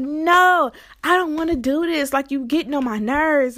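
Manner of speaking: loud, high NO, then irritated complaint; exasperated refusal. Retold or imitated. Driver fed up with passenger's errand, exasperated refusal.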